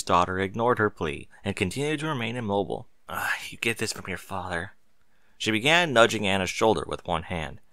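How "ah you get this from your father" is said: breathless whisper aside. Acted. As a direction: whisper it to herself. Mother aside over sleeping child.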